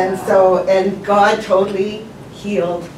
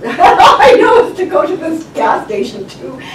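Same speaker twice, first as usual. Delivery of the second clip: loud, laughing retelling; amused at the remembered panic; laughing while telling; 5 semitones higher, 8 dB louder than usual. Real, not acted. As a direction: push the words out through laughter.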